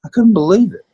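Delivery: high, loud burst of real disbelief; the memory still astonishes you, let it jump out; recalling how teammates taught him; amazed disbelief. Real, not acted.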